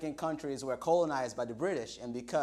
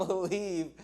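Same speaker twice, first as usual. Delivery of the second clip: usual speaking voice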